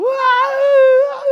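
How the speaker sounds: loud, high, drawn-out bawl; hammed-up howl of crying; mock wail. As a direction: imitate your own loud wailing, stretched out. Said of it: "Saya nangis".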